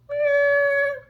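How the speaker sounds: high sung violin note; violin imitation. To her listeners, imitating the violin she heard, a high note. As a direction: mimic the violin's first note, high and sustained. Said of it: "I just hear the violin go".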